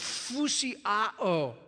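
"fusiao" drawn out slowly, f blown like escaping air; sounds like inflating. Shown as a demonstration. Preacher showing that "fusiao" sounds like inflating, slow and puffed. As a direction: stretch "fusiao" with a long airy f. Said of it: "it makes that sound".

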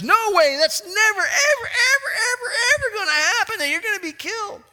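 very high, loud, near crying "ever, ever"; anguished protest. Acted. Peter protesting Jesus will be killed, near tears. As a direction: cry out against it in horror.

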